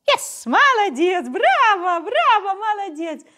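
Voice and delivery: high, loud gushing praise; excited praise. Real, not acted. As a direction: cheer the pup on. Praising dog after success.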